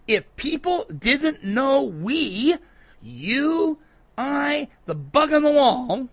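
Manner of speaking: high-pitched, with "we", "you", "I" each set apart; emphatic listing. Retold or imitated. Drives home who "we" means.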